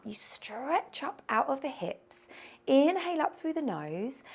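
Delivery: ordinary talk, nothing marked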